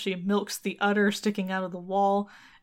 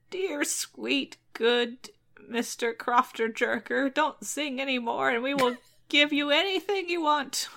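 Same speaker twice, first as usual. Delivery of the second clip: wheedling; 5 semitones higher than usual. A narrator's reading. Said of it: "she pivots to a new strategy".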